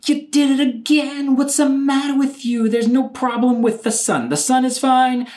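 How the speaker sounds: high, loud exasperated scolding; exasperated outrage. Real, not acted. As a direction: scold him, fed up and loud. Outraged at the cop.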